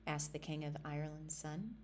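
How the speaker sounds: ordinary delivery, nothing marked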